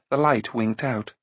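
ordinary delivery

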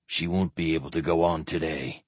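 low, softened squire's voice; quiet. A narrator's reading. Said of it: "the squire said quietly".

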